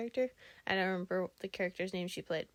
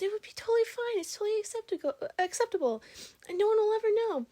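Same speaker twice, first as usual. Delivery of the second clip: much higher, louder put-on voice insisting it's fine; imitating the guy; 13 semitones higher, 5 dB louder, faster than usual. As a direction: sell the bad idea with cartoon confidence. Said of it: "the guy goes".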